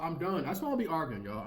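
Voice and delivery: usual speaking voice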